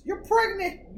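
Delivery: high-pitched mock verdict; imitating dream-reading mother. Mother telling a woman what her dream means, high mock pronouncement. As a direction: announce the diagnosis in a high voice. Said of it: "When it's women".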